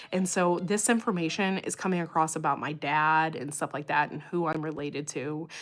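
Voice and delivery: ordinary talk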